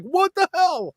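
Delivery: high-pitched retold disbelief; mock startled yelp. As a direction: yelp it high, as if suddenly shoved. Retold startled reaction to being shoved.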